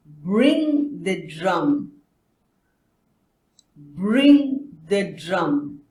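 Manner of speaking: slow teacher modelling; very slow model. Shown as a demonstration. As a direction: Bring the drum drawn out very slowly. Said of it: "repeat after".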